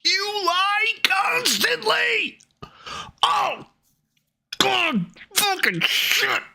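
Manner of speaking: high, slow raging shout; furious scream. Real, not acted. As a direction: scream it in full rage. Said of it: "I'm trying not to scream".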